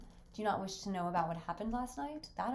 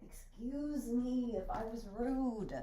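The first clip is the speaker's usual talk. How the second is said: very slow, drawn-out apology; too theatrical, slow take; overdone apology; 2 semitones higher, slower than usual. Shown as a demonstration. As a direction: let the apology drag, overplayed. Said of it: "I'm getting taken over by the performance".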